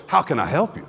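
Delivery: bright, eager offer to help. Shown as a demonstration. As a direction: ask it like you can't wait to help. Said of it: "it could be one of anticipation".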